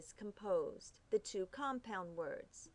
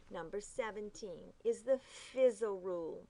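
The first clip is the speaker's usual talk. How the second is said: ordinary talk, nothing marked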